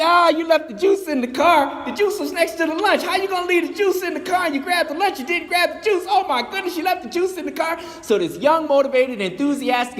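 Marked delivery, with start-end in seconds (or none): 0.0-7.8 high, fast, teasing scolding; high-pitched comic scolding over the juice; mock exasperation. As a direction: pile on the joking complaint. Friends scold about forgotten juice.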